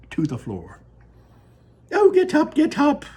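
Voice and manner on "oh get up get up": high, loud delighted cry; cried out gleefully. Acted. Wonka urging the fallen passengers up, loud and delighted. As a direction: call them up off the floor in glee. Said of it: "cried Mister Wonka, roaring with laughter".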